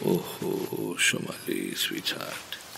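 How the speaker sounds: whispered, tender calming; soothing whisper. Acted. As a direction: hush her gently. Husband soothing wife.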